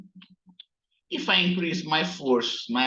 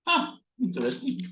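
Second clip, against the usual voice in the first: surprised; 11 semitones higher, faster than usual. Real, not acted. Software glitch surprises the teacher.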